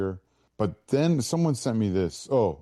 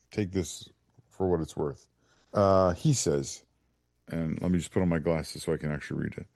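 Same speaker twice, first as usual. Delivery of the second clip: ordinary delivery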